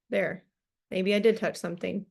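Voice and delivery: ordinary delivery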